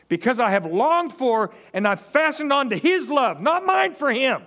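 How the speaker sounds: rising, forceful scripture reading; preaching. Acted. Pastor preaching a psalm to his church, building in force. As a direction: preach the verse out to the congregation.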